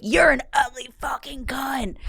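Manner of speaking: retold angry outburst. Comic retelling her reply to a heckler, mock-angry yell. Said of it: "made me so mad".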